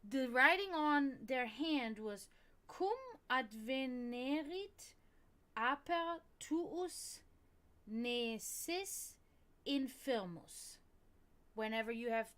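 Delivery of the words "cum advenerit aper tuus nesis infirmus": reading Latin very slowly; slow careful reading. Shown as a demonstration. Teaching viewers to read the Latin phrase slowly. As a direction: read each Latin word deliberately. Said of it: "read the whole thing".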